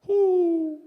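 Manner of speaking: a single high, loud hoot; distant owl hoot. Retold or imitated. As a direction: answer like an owl across the water. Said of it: "all of us hear".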